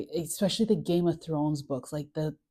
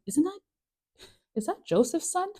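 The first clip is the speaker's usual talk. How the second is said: surprised townsfolk; 11 semitones higher than usual. Retold or imitated. Synagogue crowd recognizing Jesus.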